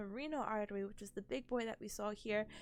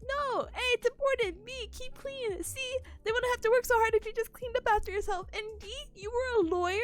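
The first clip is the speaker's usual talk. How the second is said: earnest retold plea; 11 semitones higher, 6 dB louder than usual. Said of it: "I'm like".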